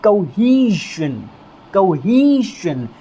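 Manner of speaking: कोहेशन said slowly as koh-HEE-shun; pronunciation demo. Shown as a demonstration. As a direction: draw out कोहेशन, syllable by syllable. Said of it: "इसका उच्चारण क्या होता है?".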